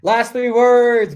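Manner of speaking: loud, high rallying call; excited hype. Real, not acted. Coach announcing the last exercise, hyped. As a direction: pump up the crowd for the finish.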